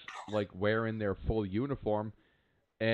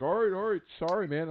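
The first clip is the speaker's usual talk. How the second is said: higher-pitched, placating imitation; imitated hasty apology; 8 semitones higher than usual. Stranger apologizes to the flyer he startled, placating. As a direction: back off apologetically, as a retold quote.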